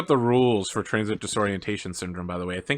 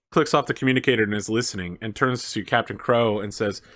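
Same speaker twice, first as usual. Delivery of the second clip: usual speaking voice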